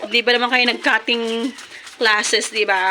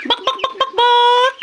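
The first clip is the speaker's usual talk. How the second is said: high chicken-call clucks; 11 semitones higher than usual. Real, not acted. Vlogger calling her chickens to feed with clucks.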